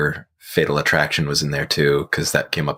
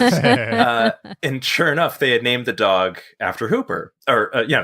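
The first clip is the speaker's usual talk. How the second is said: ordinary delivery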